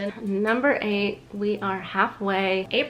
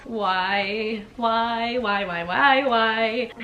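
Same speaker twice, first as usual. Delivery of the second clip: embarrassed groaning; 3 dB louder, much slower than usual. Real, not acted. Groaning at ex-boyfriend entry.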